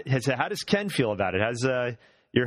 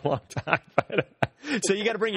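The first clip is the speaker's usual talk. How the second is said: laughing; 10 semitones higher than usual. Real, not acted. Laughs while retelling the text.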